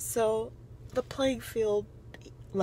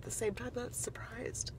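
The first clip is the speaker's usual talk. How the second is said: whisper; 13 dB quieter than usual. Real, not acted. Trailing self-correction.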